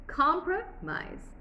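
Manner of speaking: "Compromise" high and slow, in two chunks, compro-mise; spoken syllables. Shown as a demonstration. Teacher counting spoken syllables, "Compromise" slowly in two beats. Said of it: "It's two syllables".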